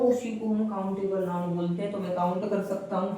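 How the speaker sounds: usual speaking voice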